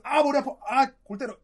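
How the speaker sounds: mock exasperation. Teacher acting out a baffling situation, mock exasperation. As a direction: play it stumped and bothered. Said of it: "골 때리는 거예요".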